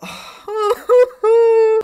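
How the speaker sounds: high wailing groan of despair; crying sigh. Real, not acted. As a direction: let out a high pained sigh. After game loss.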